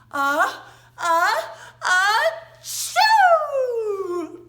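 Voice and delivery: loud, building mock sneeze; sneeze demo. Shown as a demonstration. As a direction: build up to a big pretend sneeze. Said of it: "Can you sneeze?".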